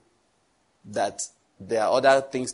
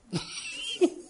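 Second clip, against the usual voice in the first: high breathy laugh; 6 dB quieter than usual. Real, not acted. Preacher laughs at own joke.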